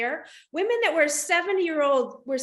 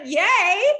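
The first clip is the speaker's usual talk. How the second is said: a loud, higher cheer of delight; mock-thrilled yay at the discount; 6 semitones higher, 9 dB louder than usual. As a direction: cheer like you just won. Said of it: "And I was like".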